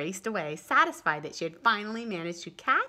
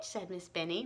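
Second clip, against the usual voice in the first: ordinary delivery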